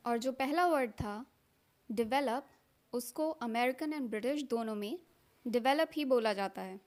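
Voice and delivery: ordinary talk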